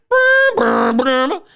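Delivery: droning bagpipe noise, very high and loud; mock bagpipe drone. Mocking the street piper's bagpipe, high loud drone. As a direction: buzz out a bagpipe sound with your lips.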